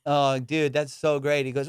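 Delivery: ordinary delivery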